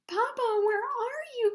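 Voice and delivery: high, loud frightened call; a small whale calling out in fear; crying out. A narrator's reading. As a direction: call out lost in the dark. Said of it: "cried Little Blue".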